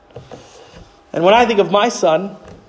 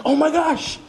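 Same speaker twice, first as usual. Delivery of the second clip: high, amazed gasp of discovery; awed realization, slowing at the end; sudden realization; 9 semitones higher than usual. Retold or imitated. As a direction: struck with wonder, pitch up high. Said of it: "it hit me".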